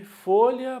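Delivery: FOLHA high and loud on FO; stressed penultimate syllable. Shown as a demonstration. Teacher naming things around the room, stress on FO in "folha". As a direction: punch the first syllable of "folha". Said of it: "paroxítona".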